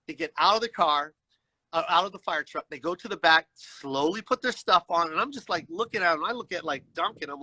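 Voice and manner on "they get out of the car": ordinary talk, nothing marked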